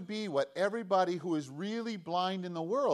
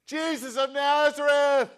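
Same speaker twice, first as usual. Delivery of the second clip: shouting all the more; 11 semitones higher, 11 dB louder than usual. Retold or imitated. Blind man refusing to be silenced, shouting louder. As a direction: bellow the name, pitched up high. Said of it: "he shouted all the more".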